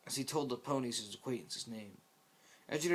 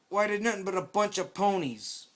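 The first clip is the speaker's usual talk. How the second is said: higher, louder dismissive blurt; 8 semitones higher, 6 dB louder than usual. Acted. Scout scoffing at the ponies, blurted. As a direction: blurt it out scornfully. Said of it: "Scout blurted out".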